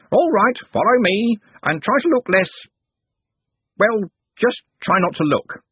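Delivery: put-on fussy old servant barking orders; pompous candle knave. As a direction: order the apprentice along with disdain. Smeems voiced, high loud.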